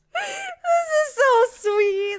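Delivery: high, strained squeal of delight; near-crying gush over a cute moment. Real, not acted. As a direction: overcome with sweetness, voice cracking high. Gushing over sweet book scene.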